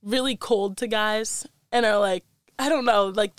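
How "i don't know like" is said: ordinary talk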